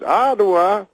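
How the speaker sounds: high, rising echo of the word; Bill Cosby impression. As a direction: repeat it high as a comic question. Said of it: "He goes".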